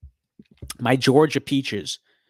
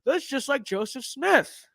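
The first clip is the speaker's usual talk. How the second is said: high mock-awed "Whoa", then drawn out; imitating amazed fans; 9 semitones higher, slower than usual. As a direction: gush like a starstruck believer, hammed up. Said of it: "they're like".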